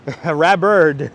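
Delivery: slow laughing word; drawn out through a laugh. Real, not acted. Laughing at own joke.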